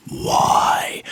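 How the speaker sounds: breathy whispered cry of why; mock whisper-shout. Ranting vlogger repeats the question as a dramatic whisper-shout. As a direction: hiss it out like a stage whisper. Mock despair over guitar tone.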